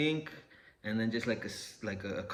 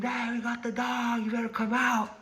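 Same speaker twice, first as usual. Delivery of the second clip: screamed warning; 11 semitones higher than usual. Retold or imitated. Officer screams to hidden man to come out, imitated high shout. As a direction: imitate a searcher yelling from a distance. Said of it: "he screamed".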